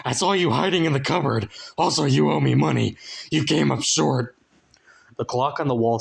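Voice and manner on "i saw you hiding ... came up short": higher, indignant little runt; lightly voiced runt. A narrator's reading. The runt rebukes Frank, higher voice. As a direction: call out his bluff.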